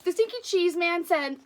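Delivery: lively read-aloud storytelling voice; animated children's story reading; story narration. A narrator's reading. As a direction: read it like a picture book to kids.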